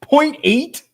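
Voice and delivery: high, slow, incredulous; can't believe the odds; stunned disbelief. Real, not acted. Reacting to rare odds.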